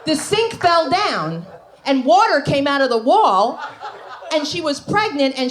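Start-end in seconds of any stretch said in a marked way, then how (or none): 0.0-3.6 drawn out slowly, "sink" leaned on; slow emphatic repeat. Acted. Comedian repeats that the sink fell down, slow for the laugh. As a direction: let it land slowly.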